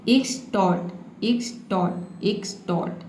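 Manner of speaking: Extort slowly, "eks-tort", falling each time; slow, measured teacher's demo of extort as "eks... tort"; model pronunciation. Shown as a demonstration. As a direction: repeat extort slow and soft, syllables drawn apart. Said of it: "इसे हम पढ़ेंगे".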